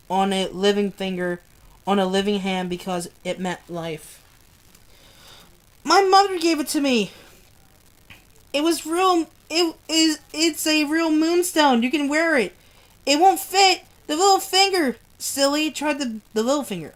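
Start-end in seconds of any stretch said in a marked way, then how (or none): 5.8-16.9 a hint of a young woman, higher and eager; lightly voiced girl. Karen tells Joe about the moonstone, lightly voiced. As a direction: play her lightly, excited about the ring.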